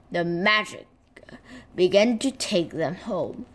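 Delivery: ordinary delivery, nothing marked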